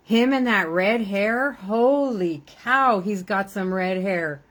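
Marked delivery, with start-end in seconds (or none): 1.5-3.0 slow, stretched amazement; drawn-out holy cow. Real, not acted. Grandmother marveling at grandson's red hair, slow and amazed. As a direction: savour the exclamation very slowly.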